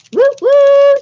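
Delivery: high, loud "woo woo" whoop; celebratory woo-woo. Real, not acted. Host cheers a player who won points, celebratory whoop. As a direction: whoop twice, high and loud, second one held.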